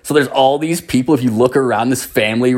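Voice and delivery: usual speaking voice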